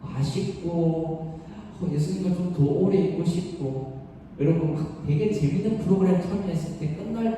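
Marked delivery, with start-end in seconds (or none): none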